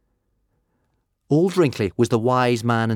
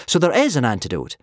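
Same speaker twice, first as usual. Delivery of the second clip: higher, louder, hopeful relief; hugely relieved; 7 semitones higher, 5 dB louder than usual. A narrator's reading. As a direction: let relief lift the voice. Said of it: "hugely relieved".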